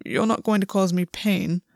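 mildly puzzled; a touch of confusion, held back; confused. A narrator's reading. Gwen questions August's unusual gentleness, mildly confused.

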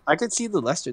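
usual speaking voice

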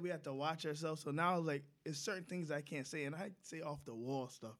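ordinary talk

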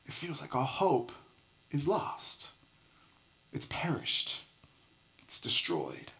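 breathy dramatic whisper. Acted. Preacher whispering to the church as Belle's tear falls. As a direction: whisper it like the last hope dying.

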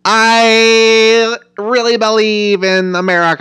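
high, loud, slow over-earnest declaration; forced earnest Hillary. Retold or imitated. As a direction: overdo the sincerity like a stiff candidate. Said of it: "And she's like".

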